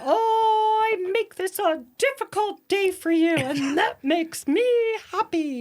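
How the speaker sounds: put-on high, hammy posh English accent; fake English accent. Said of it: "the fake English accent".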